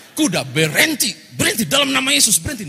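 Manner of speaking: high, loud frantic stop; panicked yell. Retold or imitated. Pastor screams at his runaway horse in panic.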